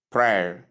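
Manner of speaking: prayer as one syllable rhyming with air; prayer as P-R plus the diphthong "air"; correct pronunciation. Shown as a demonstration. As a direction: model prayer slowly, PRAIR, like wear. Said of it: "that is".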